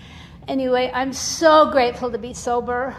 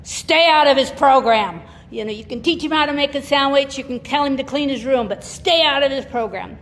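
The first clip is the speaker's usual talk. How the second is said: firm sponsor advice; 2 semitones higher, 7 dB louder than usual. Retold or imitated. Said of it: "they're like".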